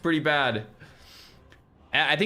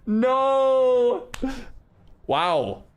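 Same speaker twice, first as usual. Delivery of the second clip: shocked disbelief; 9 semitones higher, much slower than usual. Real, not acted. Reacting to UK chatter.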